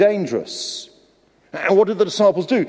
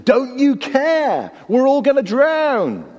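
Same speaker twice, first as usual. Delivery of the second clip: high, slow anguished cry at the teacher; frantic plea to a sleeping master; desperate cry; 10 semitones higher, 3 dB louder, slower than usual. Acted. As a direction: cry out in panic, dragging the words. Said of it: "So they shouted at him".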